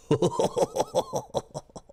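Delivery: squeaky, very high laugh; high giggle. Real, not acted. Streamer cracking up at his own silly voice, very high giggle. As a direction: burst into a shrill giggle.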